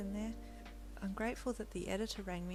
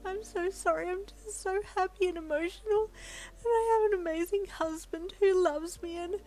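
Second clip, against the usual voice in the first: high, loud, emotional wail of happy tears; 13 semitones higher, 7 dB louder than usual. A narrator's reading. Wife wailing to the editor about her amazing husband, in happy tears. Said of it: "you wailed".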